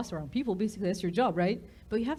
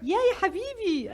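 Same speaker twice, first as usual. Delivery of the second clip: breezy; 10 semitones higher, 5 dB louder than usual. Retold or imitated. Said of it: "She's like".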